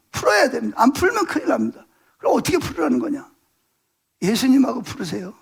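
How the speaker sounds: ordinary talk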